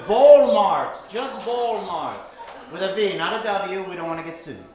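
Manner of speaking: ordinary talk, nothing marked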